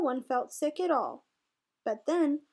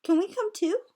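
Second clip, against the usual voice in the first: high eager little ask; eager plea; 6 semitones higher, 3 dB louder than usual. A narrator's reading. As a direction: beg to tag along. Bunnies begging to join.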